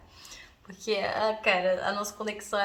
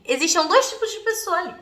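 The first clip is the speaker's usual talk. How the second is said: ordinary delivery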